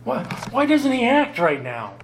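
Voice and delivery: a hurt heart crying out why; voicing the doubting heart. Preacher voicing the listener's heart, pleading and high. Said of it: "does your heart not sometimes say".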